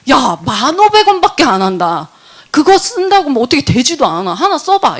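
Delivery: exasperated, loud, climbing in pitch; acted-out impatient persuasion; retold insistent urging. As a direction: talk a reluctant friend into it.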